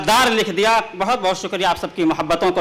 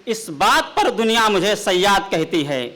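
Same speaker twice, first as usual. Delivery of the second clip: measured, declaiming poetic delivery; ghazal recitation; 4 semitones higher, slower than usual. Acted. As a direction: recite the couplet grandly.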